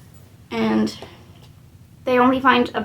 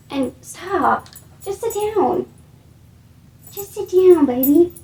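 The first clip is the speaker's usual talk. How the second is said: higher, coaxing voice for a pet; 7 semitones higher, 4 dB louder than usual. Real, not acted. Speaker to her pet, a high coaxing plea. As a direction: settle the dog down sweetly.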